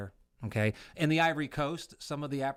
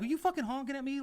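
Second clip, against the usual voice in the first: angry; 12 semitones higher, faster than usual. Retold or imitated. Angry retold road-rage confrontation.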